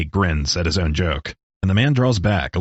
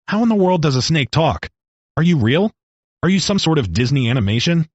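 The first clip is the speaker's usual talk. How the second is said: higher, faster, tumbling panicked questions; bewildered questions; 6 semitones higher than usual. Acted. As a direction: fire off the questions in disbelief. Man bewildered by talking snake.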